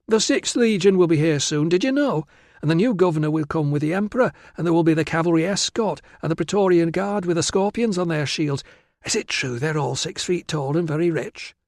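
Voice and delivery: put-on high, quick girlish gush; excited chattering girl. Virana gushes to Tilla about the soldiers, excited.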